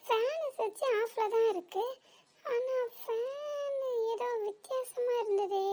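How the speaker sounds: soft, high, slowing talk to oneself; puzzled musing. Acted. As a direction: wonder quietly, drawing words out. Puzzling to herself about the fan. (மெதுவாகத் தனக்குத்தானே யோசிப்பது)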